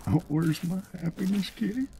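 high, pleading mock whine; hammed-up high sad whine; whiny sad dog owner. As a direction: whimper like he misses his cat. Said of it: "He's just like".

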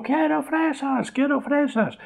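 put-on very high, slow whining child cutting in; shrill-pitched mock wail of a boy; child interrupting with his demand; child's tantrum.

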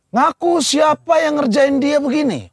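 high, slow mock interrogation; demanding mother figure. Woman demands the prankster confess, mock-stern and high. Acting the woman questioning the children.

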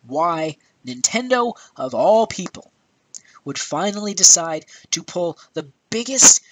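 high-pitched, stretched-out disbelief; exasperated rant. Real, not acted. Ranting about Nintendo's stupidest mistake, exasperated. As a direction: punch the words in incredulous exasperation.

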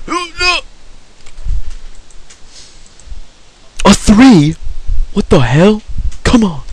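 high-pitched frustrated protest; exasperated, pitch jumping up; dismayed disbelief. Real, not acted. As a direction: cry out at a bad roll. Frustrated reaction to game hits.

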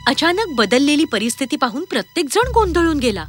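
usual speaking voice

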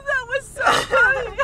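crying with laughter, slow; laughing. Real, not acted. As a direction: laugh through every word. Laughing at the accent voices.

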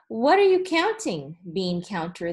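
usual speaking voice

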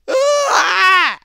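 loud, high scream; panicked scream. Real, not acted. Gamer caught out after dark by monsters, screaming in fright. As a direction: scream as if ambushed.

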